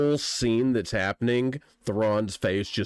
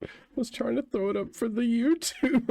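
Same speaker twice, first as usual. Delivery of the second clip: ordinary talk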